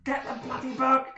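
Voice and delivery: high-pitched urgent command; urgent yelled order. Acted. Player ordering an ally to grab the book, urgent yell. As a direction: yell it across the fight.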